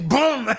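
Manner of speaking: boom. Pretend. Co-host joking about showing the wrong way, with a mock gunshot. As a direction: blurt a gunshot noise.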